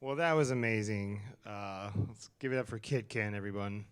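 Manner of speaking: hyped host working the crowd; in front of an audience. Acted. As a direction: project out to a live crowd like an MC. Host hyping a live audience.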